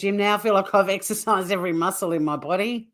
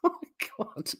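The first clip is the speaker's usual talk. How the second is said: soft, voiceless gasp through laughter; breathless laughter; 3 semitones higher, 10 dB quieter, faster than usual. Real, not acted. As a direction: squeeze it out while laughing. Helpless laughter at the clip.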